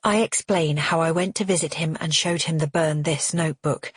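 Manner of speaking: ordinary delivery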